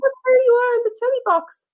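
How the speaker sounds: high sing-song voice for the dog; pet talk. Real, not acted. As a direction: coo at the pet. Talking to the dog.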